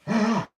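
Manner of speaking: sharp high punching grunt; grunt of effort. Retold or imitated. Mimicking his own grunt on the pads. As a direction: shout it out like landing a heavy punch.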